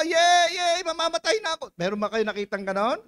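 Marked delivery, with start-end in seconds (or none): 0.0-1.7 high, hammed-up cheer; mock yay, high and gleeful. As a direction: pretend to cheer at dying. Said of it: "dapat nagsisisigaw ka".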